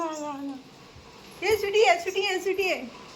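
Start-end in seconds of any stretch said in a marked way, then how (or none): 1.4-3.1 high, eager repeated call; higher-pitched talk to a pet; coaxing pet call. Real, not acted. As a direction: coax a pet over to you. Calls pet to come.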